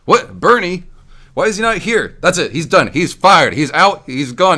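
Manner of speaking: high, loud furious tirade firing a student; angry teacher. Retold or imitated. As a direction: rant at the missing boy, outraged. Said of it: "she'd be like".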